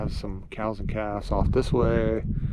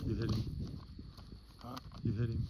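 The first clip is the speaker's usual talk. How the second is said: wildlife watching whisper; 9 dB quieter than usual. Real, not acted. Hunters whispering on the bull.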